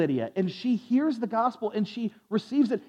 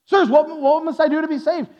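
higher, louder acted question; jailer's urgent plea; 6 semitones higher, 5 dB louder than usual. Jailer to Paul after the miracle, pleading loudly.

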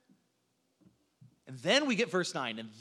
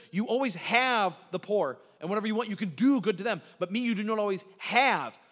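"have" and "do" punched louder than the rest; stress on have and do; 3 semitones higher, 5 dB louder than usual. Shown as a demonstration. Preacher repeating Jesus's words, stressing "have" and "do". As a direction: hit each "have" and "do" hard. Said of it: "the emphasis on the verbs have and do".

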